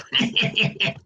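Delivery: soft knowing witch chuckle to herself; a quiet sly cackle; witch's chuckle. A narrator's reading. Witch laughing to herself over Jerome, soft chuckle. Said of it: "laughing to herself".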